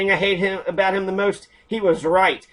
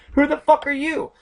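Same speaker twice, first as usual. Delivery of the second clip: angry demand; 9 semitones higher than usual. Real, not acted. Demanding troll's identity.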